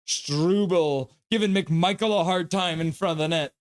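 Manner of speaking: normal talking voice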